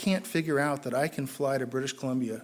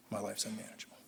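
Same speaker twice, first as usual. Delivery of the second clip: much softer, low confession; hushed admission; 7 semitones lower, 16 dB quieter, faster than usual. Real, not acted. Recovering alcoholic finally admitting it, barely above a murmur. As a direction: drop it to a near murmur.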